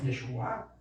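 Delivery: "Yeshua" with the accent on the last A; low, rising onto the final A of "Yeshua"; "Yeshua" said as yeshu-A; stress on final A. Shown as a demonstration. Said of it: "con acento en la A".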